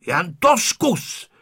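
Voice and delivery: bristling, drawn-out challenge. A narrator's reading. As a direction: dare the bully, stretching each word. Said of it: "načepířil se Honzík".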